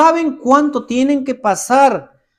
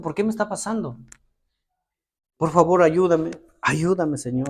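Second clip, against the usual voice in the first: hushed, much softer, pleading prayer; quiet troubled prayer; 4 semitones lower, 6 dB quieter than usual. Retold or imitated. Preacher showing how he prays when upset, hushed and pleading. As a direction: pray low and weary, asking for help. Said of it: "a veces estoy así".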